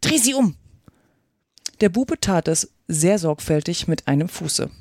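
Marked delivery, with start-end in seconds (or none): 0.0-0.5 higher-pitched, cross command; annoyed. A narrator's reading. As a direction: order it crossly in a raised pitch. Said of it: "wandte sich ärgerlich von ihm ab". (ärgerlicher Befehl)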